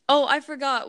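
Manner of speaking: normal talking voice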